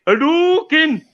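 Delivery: high shouted fighting-game battle cry; Ryu shout. Retold or imitated. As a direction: yell the special move like Ryu. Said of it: "I literally heard Ryu say".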